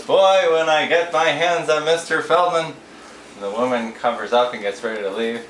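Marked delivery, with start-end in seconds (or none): 0.0-2.7 louder, higher, mock-furious rabbi; hammed-up outraged threat. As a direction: play the rabbi fuming at Feldman. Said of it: "the rabbi is furious".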